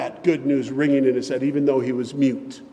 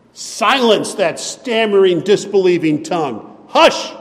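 commanding shout; 4 semitones higher, 8 dB louder than usual. Retold or imitated. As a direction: bark the order to silence him. The angel silences Zechariah.

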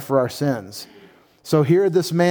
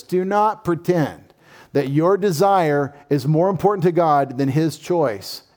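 ordinary talk

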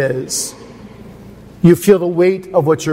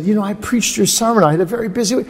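put-on sheepish young preacher; 7 semitones higher, faster than usual. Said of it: "The man said".

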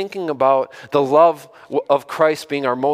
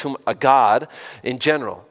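ordinary talk, nothing marked

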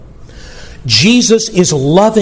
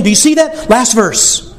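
ordinary talk

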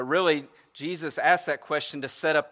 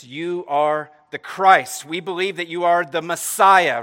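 slow, much louder proclamation; emphatic confession; 3 semitones higher, 10 dB louder than usual. Acted. Peter declaring to Jesus he is the Messiah, loud and slow. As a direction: proclaim it with conviction.

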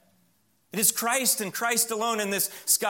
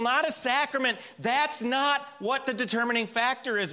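rising, pressing sermon cadence, higher pitched; preaching at full force; 6 semitones higher than usual. Acted. Preacher to his congregation, insisting on faith alone, at full force. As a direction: hammer each "not a" home to the congregation.

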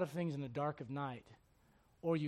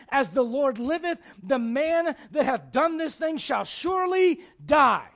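slow, loud, high-pitched pronouncement; kindled anger; 9 semitones higher, 14 dB louder, slower than usual. Acted. David condemning the man to Nathan, preached anger. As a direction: thunder it out as an angry king's sentence. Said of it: "David's anger was greatly kindled".

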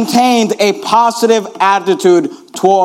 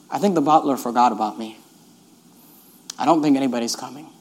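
quiet despair; 10 semitones lower, 9 dB quieter than usual. Retold or imitated. Joseph realizes he's forgotten.

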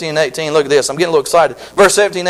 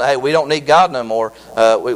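ordinary talk

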